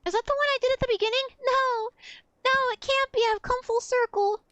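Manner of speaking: fast, high mock anguish; hammy despair. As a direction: play it like a melodramatic villain. Dramatic realization, mock despair.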